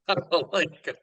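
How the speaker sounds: headline read through a chuckle; laughing. Shown as a demonstration. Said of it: "would that ever pop up".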